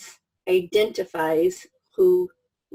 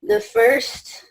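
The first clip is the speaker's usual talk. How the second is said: usual speaking voice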